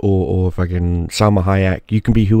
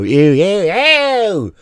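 disgusted ew; 10 semitones higher, much slower than usual. Real, not acted. Disgusted ew in rant.

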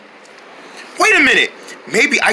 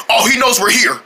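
usual speaking voice

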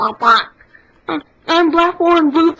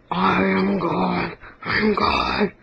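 lower, softer, slow booming deity; God voice; 10 semitones lower, 5 dB quieter, slower than usual. Pretend. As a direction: intone it deep and slow. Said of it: "One hundred impressions".

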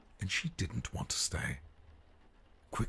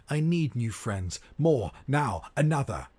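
loud, high demanding cry; 9 semitones higher, 8 dB louder than usual. Acted. Spoiled prince crying out for new friends, loud and high. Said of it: "The prince cried out".

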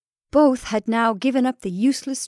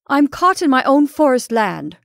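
loud, higher, furious comeback; trapped man snapping back at his neighbour; angry retort; 5 semitones higher, 8 dB louder than usual. Acted. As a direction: fire it straight back at him. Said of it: "retorted Ulrich".

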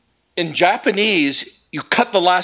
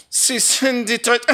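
mock German villain; 6 semitones higher than usual. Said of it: "German is the easiest language of all to laugh in".

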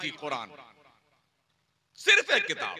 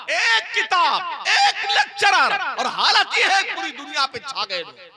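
high, loud, stretched preaching cry; fiery preaching; 7 semitones higher, 6 dB louder than usual. Real, not acted. Preacher extolling early Muslims to the gathering, fiery and loud. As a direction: rouse the crowd to a peak.

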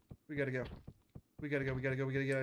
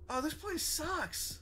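whiny moan; 12 semitones higher, much slower than usual. Real, not acted. Miserable in dark zone.

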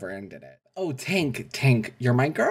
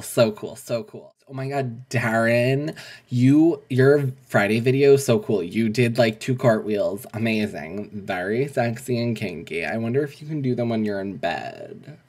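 usual speaking voice

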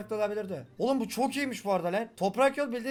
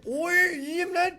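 high, slow, drawn-out excited cry; 8 semitones higher, 4 dB louder, much slower than usual. Real, not acted. Player thrilled he can eat the item, drawn out high. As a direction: stretch it out with glee.

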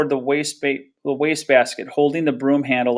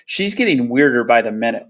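ordinary delivery

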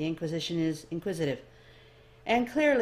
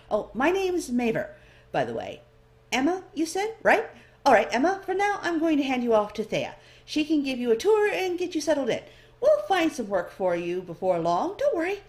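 much higher, louder chatty elf voice; bright curious clerk sizing up the newcomer; eager elf librarian; 9 semitones higher, 7 dB louder than usual. A narrator's reading.

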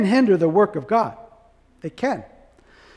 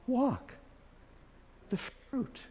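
whispered emphasis; 2 semitones higher, 19 dB quieter, much slower than usual. Real, not acted. Preacher to congregation, a whispered point. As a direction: breathe the words out in a whisper.

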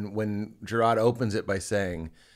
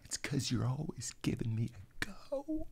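slow teasing whisper; 15 dB quieter, slower than usual. Real, not acted. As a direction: whisper it slowly like an inside joke. Teasing aside to friend.

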